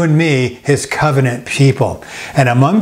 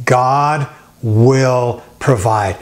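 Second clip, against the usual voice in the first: God will provide, said slowly and firmly; slow, emphatic; much slower than usual. Shown as a demonstration. Pastor leading the congregation in the phrase, slow and emphatic. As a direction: lead the church in it, slow. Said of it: "Maybe you can say that with me".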